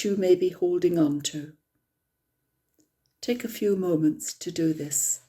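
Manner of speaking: calm, unhurried meditation guide; guided meditation. Acted. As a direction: ease the group gently into stillness.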